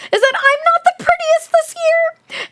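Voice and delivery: very high, gleeful squeal; thrilled, high-pitched character voice; giggly. A narrator's reading. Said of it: "Angeline giggled".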